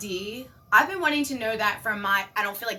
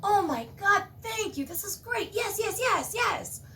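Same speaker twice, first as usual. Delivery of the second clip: blissful delight; 9 semitones higher than usual. Retold or imitated. Voicing her blissful high-vibe self, delighted. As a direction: melt into delighted gratitude. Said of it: "I'm used to".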